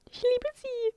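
high, doting gush. Real, not acted. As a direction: coo over her lovingly. Doting on the cat.

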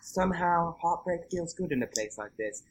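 whisper-close ad delivery, lightly imitated; hushed Nicole line. Quoting the famous AMC line to her co-host, hushed and reverent. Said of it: "on her face".

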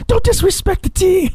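very high-pitched mock scolding; mock outrage. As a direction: squeal it like an offended superfan. Mock scolding of bandwagon fans.

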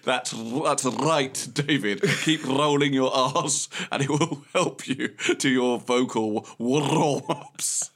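slow, drawn-out mock voice of [name] from the grave; a hammed-up ghostly [name], slow and solemn; [name] from beyond. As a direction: intone it slowly, like a ghost giving advice. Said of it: "And saying".

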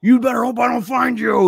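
high, sustained imitated threatening shout; menacing scream. Driver hunting kids hidden in the woods, menacing scream. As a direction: holler it out as if across woods. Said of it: "he's screaming and it's echoing in the woods".

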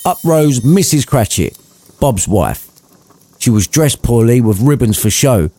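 plain reading voice

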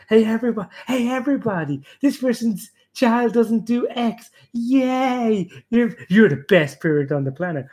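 fake-cheer it like handing out a prize; sarcastic cheer. Mocking a smug parent by announcing their success, sarcastic cheer.

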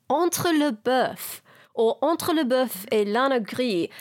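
put-on slow dodgy French accent. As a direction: carefully sound out the French title in a French accent. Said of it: "my dodgy French accent".

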